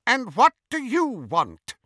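high, imperious, scornful demand; haughty contempt. A narrator's reading. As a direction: look down on an unwelcome intruder. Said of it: "with as much contempt as she could muster".

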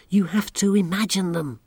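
plain reading voice

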